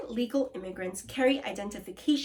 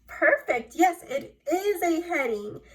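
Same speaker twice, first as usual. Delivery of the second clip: excited praise; 6 semitones higher, 6 dB louder than usual. Real, not acted. Praising correct class answer.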